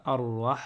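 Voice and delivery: "arrah" with doubled r, ending on a breathy h; "arrah", no vowel after the final h; closed with soukoun. Shown as a demonstration. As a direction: hold the r and stop on the h of "arrah". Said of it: "parce qu'il y a un soukoun".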